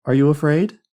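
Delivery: high, loud rising question; concerned calling question. A narrator's reading. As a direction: ask it across a dark room. Louise calling to Marion in dark.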